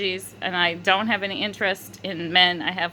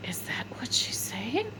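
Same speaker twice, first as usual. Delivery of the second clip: hushed, breath-only question; retold whisper; 10 dB quieter than usual. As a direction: whisper it in disbelief to your sister. Asking sister privately, retold.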